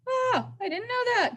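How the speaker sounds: high, surprised gasp. Shown as a demonstration. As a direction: act amazed. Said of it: "allows you to be surprised".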